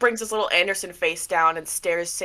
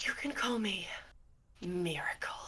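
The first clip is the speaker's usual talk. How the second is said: very soft, hushed self-introduction; ominous whisper; 13 dB quieter than usual. Acted. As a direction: introduce yourself in a breath of whisper. Miracle announces a new name.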